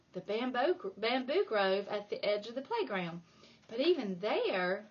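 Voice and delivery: usual speaking voice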